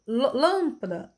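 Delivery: "lâmpada" with "lâm" drawn out slowly; long stressed syllable. Shown as a demonstration. Teacher demonstrating a long syllable, "lâmpada" stretched on "lâm". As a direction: hold the first syllable of "lâmpada". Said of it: "era a mais longa e a mais forte".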